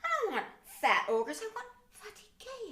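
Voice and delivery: high, loud outraged protest; exasperated shriek. Retold or imitated. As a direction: yell at the phone in disbelief. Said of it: "I'm like".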